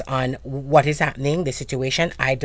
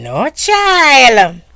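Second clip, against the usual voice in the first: Jamaican reply, higher and louder; Jamaican patois; 7 semitones higher, 7 dB louder than usual. Retold or imitated. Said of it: "we have this saying in Jamaica".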